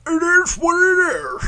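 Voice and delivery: high, drawn-out mock shout; put-on voice. Shown as a demonstration. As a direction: stretch the catchphrase in a silly high voice. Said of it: "How about if I went".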